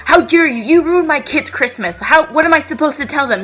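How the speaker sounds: mock furious mom. Angry mom blasting the elf worker, mock furious. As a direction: imitate an entitled mother's outrage. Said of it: "this woman just goes off on me".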